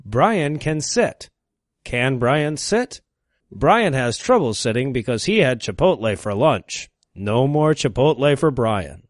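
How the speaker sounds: low, soft, deliberate reading; mock primer reading. Parody McGuffey Reader lesson, low measured reading. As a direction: read it like a simple school primer.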